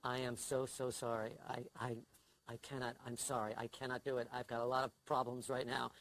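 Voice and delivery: low, hushed mock-mournful apology, gradually lifting into pleading; put-on grave, sorrowful excuse-making; funereal voice. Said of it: "I put on my funereal voice".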